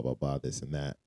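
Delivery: ordinary talk, nothing marked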